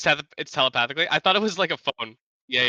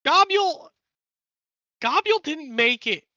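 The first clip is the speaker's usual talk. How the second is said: goblin voice; 13 semitones higher than usual. Acted. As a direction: speak as a grand goblin storm caller. Plays goblin Gobnarl telepathically.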